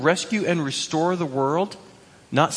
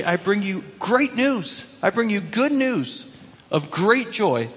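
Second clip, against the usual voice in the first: slow, raised-pitch herald's announcement; excited angels; 5 semitones higher than usual. Acted. Angels announcing news to lowly shepherds, excited. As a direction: proclaim it high and drawn out, stressing great. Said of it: "why are these angels so gosh darn excited".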